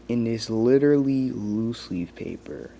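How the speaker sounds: ordinary delivery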